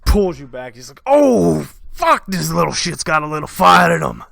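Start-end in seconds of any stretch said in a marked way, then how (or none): 1.0-4.2 much louder, higher roaring rage; wounded bandit king yelling at the boy; furious bandit king. Acted. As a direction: bellow in pain and fury. Bandit king after thumb in eye.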